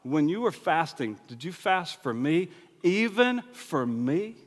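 slow, lingering "even for me"; drawn-out "even for me" to show the doubled Hebrew; stress on even. Shown as a demonstration. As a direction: lean hard on "even". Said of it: "it says it twice".

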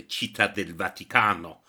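Città del Vaticano said the Italian way, rolled and sung; Italian place names in an Italian accent. Acted. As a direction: show off a fine Italian pronunciation. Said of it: "in a fine Italian accent".

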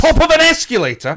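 high, loud disbelief; outraged. Real, not acted. Friend erupts about people stopping atop an escalator, loud and high. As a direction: cry it out in outrage.

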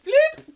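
high loud comic bloop; mock bloop as she drops; sound effect bloop. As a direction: pop out a cartoon bloop. Imitated fall sound.

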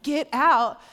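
a loud, forceful command to leave; commanding shout. Retold or imitated. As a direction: drive it out with force. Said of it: "and I'm like".

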